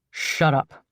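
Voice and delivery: short curt grunted command. Acted. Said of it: "a man grunts".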